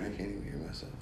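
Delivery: soft, distant off-mic voice; away from the mic. Shown as a demonstration. Guest showing they cannot hear themself, away from the mic. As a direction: say it softly, leaning away from the mic. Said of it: "from way over here".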